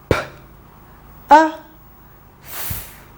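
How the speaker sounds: segmenting P, A, F; "P", then "A", then a hissed "F"; sounds said apart. Shown as a demonstration. Teacher counts the sounds aloud for the class, one by one. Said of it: "Let's count".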